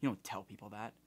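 retold whisper. Manager scolding the gate agent, a soft hushed scold. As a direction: scold under the breath. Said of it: "whispers in her ear".

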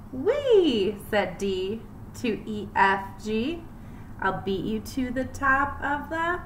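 lively rhythmic picture-book reading; reading to children. Acted. Teacher reading a rhyming book to her class, lively storytime voice. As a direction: read it bouncy for little kids.

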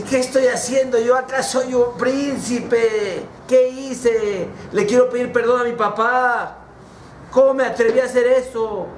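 high, dismayed outcry; acted remorseful prince; anguished self-reproach. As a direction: cry out in sudden regret, pitched high. Prince shocked, regrets his act.

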